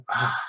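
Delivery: big exhaled sigh of relief. Retold or imitated. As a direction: let the relief out in one sigh. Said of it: "this massive sigh of relief".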